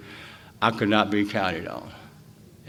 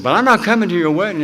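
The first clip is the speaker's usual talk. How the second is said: firm refusal; 8 semitones higher, 8 dB louder, faster than usual. Retold or imitated. Refusing pal's invitation.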